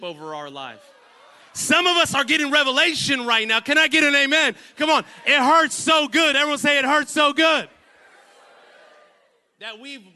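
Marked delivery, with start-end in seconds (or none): 1.5-7.7 full-force, high-pitched church shouting; rally the crowd at the top of your voice; rousing preacher shout. Real, not acted. Preacher calling the congregation to say amen, rousing shout.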